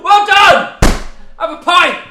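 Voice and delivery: very high, very loud cheering yell; mock congratulations. Mocking politicians praising hardworking people, shouted condescension. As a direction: holler patronising praise, hammed-up.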